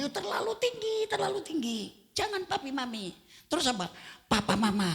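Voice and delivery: high-pitched mock wife; lightly hammed-up wife turning it down; wife rejecting papi mami as too fancy. Wife voiced in naming dialogue.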